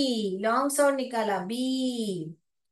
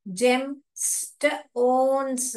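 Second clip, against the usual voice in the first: drawn out, syllable by syllable; slow sounding out; much slower than usual. Shown as a demonstration. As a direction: stretch the word slowly for the class. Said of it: "phonics reading demo". (धीरे-धीरे शब्द खींचकर)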